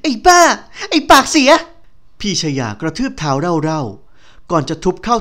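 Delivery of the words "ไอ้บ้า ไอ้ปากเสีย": very high, very loud screamed insults; an enraged heiress screaming insults at the man; piercing shriek. Acted. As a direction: shriek it so he covers his ears. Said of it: "กรีดลั่นจนชายหนุ่มต้องอุดหู".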